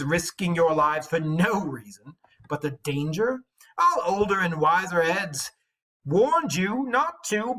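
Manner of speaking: jealous warrior mocking a rival; harsh sharp taunt. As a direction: sneer at the hero across the hall. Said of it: "spoke harshly and sharp".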